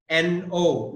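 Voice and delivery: ordinary talk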